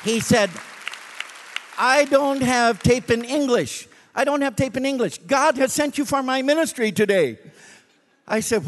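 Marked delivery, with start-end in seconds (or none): 1.8-7.4 lightly voiced pleading driver, higher; a hint of an eager foreign cabbie; taxi driver's voice. Driver begging the preacher to record a sermon, higher and eager.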